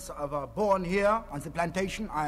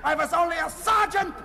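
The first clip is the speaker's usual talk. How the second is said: ranting officer; 9 semitones higher than usual. Pretend. Fake Indian shouts his army career to the interviewer. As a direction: bark out your ranks proudly. Raving about his rank.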